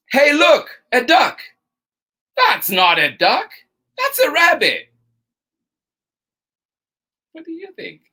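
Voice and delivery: lively, bouncy storytime read with two bickering voices; reading to children. Acted. Storytime host reading to children, animated and playful. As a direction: play both sides of the argument for the kids. Reads picture book aloud to kids.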